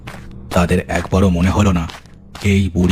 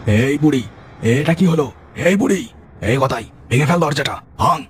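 put-on higher, urgent shouting at the old woman; panicked yell; 7 semitones higher than usual. Trapped thief yelling at the old woman, panicked shout. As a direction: call out in alarm, trapped.

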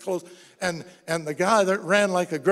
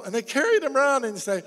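ordinary talk, nothing marked

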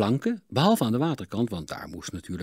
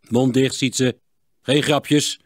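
stern louder rebuke; forbidding; 4 semitones higher, 7 dB louder, slower than usual. A narrator's reading. Smith scolds his joking son, stern. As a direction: shut the boy up sternly. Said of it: "verbood Klinkhamer".

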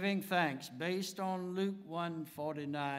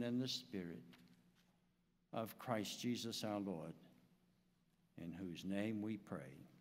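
closing prayer. Acted. Pastor leads closing prayer.

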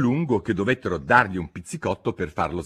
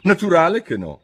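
scornful retort, high and loud; indignant, high-pitched dismissal; 10 semitones higher, 8 dB louder than usual. A narrator's reading. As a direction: scoff it out loudly as the Hatter. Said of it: "scuotendo sdegnosamente la testa".

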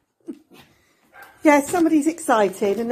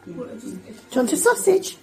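pet voice. Real, not acted. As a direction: tease the dog with the treat. Offering dog treat.

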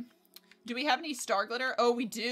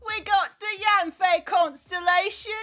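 loud, high victory yell; hype shout; 7 semitones higher, 6 dB louder, slower than usual. Real, not acted. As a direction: shout with joy at the win. Celebrating the pull.

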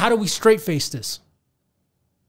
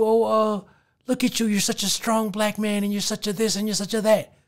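softer fawning praise; mock flatterer; 4 dB quieter than usual. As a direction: coo it like a patronizing admirer.

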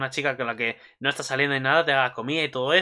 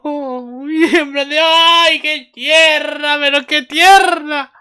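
high, loud, drawn-out squeal; cuteness overload; 13 semitones higher, 8 dB louder, much slower than usual. Acted. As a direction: squeal with adoration, stretching the words slowly. Gushing over how cute she is.

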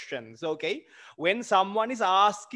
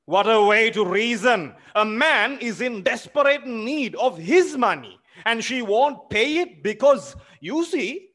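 indignant outburst; 6 semitones higher, 5 dB louder than usual. Acted. Smirnov's indignant monologue.

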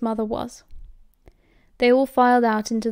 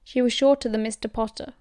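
usual speaking voice

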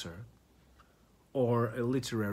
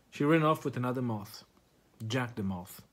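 usual speaking voice

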